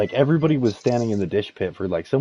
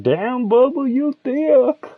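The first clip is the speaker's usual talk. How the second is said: much higher, louder mock voice; coworker voice; 6 dB louder, much slower than usual. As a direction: squeal it like a leering coworker. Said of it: "and he's like".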